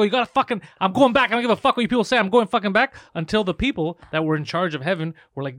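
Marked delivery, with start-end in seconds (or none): 0.0-2.9 loud, high retold rant; heated, raised-voice mock tirade; angry demand. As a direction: insist furiously, acting out the outrage. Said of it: "started to get so angry".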